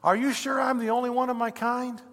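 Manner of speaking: high, incredulous question; amazed former thief. Acted. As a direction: ask like a newcomer in wonder. Thief questions the angels in wonder.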